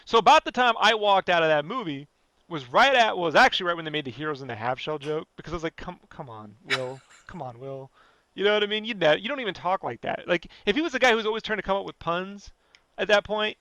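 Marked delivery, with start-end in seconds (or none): 5.8-7.9 low, much softer groan of disbelief; mock weary dismay. Viewer reacting to the Half Shell joke, weary dismay. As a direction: quietly let down, as if pleading with him to stop.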